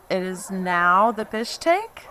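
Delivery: usual speaking voice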